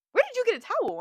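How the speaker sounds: very high, loud mock demand; astonished friend. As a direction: imitate a friend's shrieked question. Acts friend's startled question.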